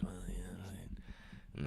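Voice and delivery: hushed aside; whisper. Real, not acted. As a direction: whisper it under your breath. Aside to friends.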